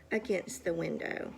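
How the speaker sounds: ordinary delivery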